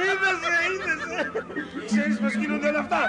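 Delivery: put-on man exulting to the girl; gleeful. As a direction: gloat with mad joy. Man gloating over his power.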